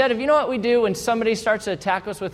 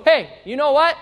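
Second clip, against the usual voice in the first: raised voice; 7 semitones higher, 5 dB louder than usual. Retold or imitated. Raised voice escalates argument.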